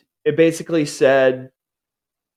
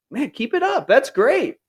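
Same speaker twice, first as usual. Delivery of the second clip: high, bright self-encouragement; positive self-talk; 10 semitones higher than usual. Shown as a demonstration. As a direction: cheer yourself on. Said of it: "thinking those thoughts to myself".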